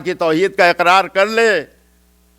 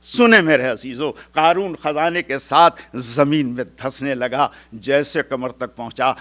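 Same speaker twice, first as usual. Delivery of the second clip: normal talking voice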